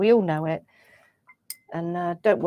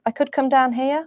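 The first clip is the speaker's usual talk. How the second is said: rising at the end like a question; uptalk; 5 semitones higher than usual. Real, not acted. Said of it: "your voice goes up at the end as if you're asking me a question".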